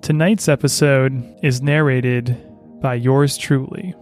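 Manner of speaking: slow, soothing bedtime host voice; sleep story narrator. Acted. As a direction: lull the listener toward sleep, unhurried. Sleep podcast host intro.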